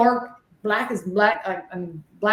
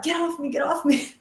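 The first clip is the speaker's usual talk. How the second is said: high, panicked repeating; frantic pleading; 7 semitones higher than usual. Retold or imitated. Medium begging the spirits crowding her, high frantic pleading. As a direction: push them away in desperation.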